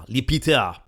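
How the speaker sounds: names it the French way, Le Peter as "li pitə"; a hammed-up French "Le Peter"; mock French accent. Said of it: "terrible approximation of your accent".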